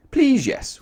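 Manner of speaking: very high, eager reply; Mister Topsy-Turvy. A narrator's reading. Mister Topsy-Turvy answering the manager, very high and eager. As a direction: chirp it back happily. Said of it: "replied Mister Topsy-Turvy".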